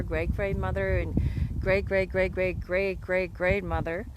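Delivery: usual speaking voice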